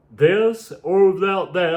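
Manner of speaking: yawning while talking; words through a yawn. Real, not acted. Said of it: "I'm sorry I'm yawning so much".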